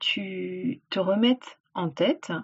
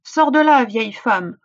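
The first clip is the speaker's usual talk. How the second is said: commanding djinn; 2 semitones higher, 6 dB louder than usual. Acted. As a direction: bark the command at her. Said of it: "ordonna-t-il".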